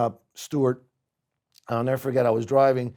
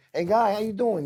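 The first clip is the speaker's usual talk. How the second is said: higher, brash New Jersey chef greeting; New Jersey accent; 7 semitones higher than usual. Retold or imitated. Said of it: "You know, guy from New Jersey".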